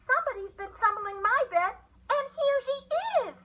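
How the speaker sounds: astonished little bear piping. A narrator's reading. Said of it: "the little bear piped".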